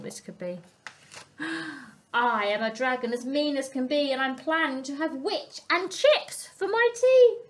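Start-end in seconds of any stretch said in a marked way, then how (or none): 2.1-7.4 louder, higher mock-menacing dragon. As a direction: threaten like a storybook dragon. Dragon threatens the witch.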